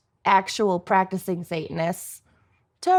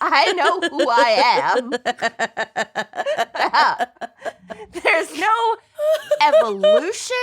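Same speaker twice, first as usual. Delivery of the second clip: high laughing squeal; 5 semitones higher than usual. Real, not acted. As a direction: laugh while admitting it. Laughing through confession.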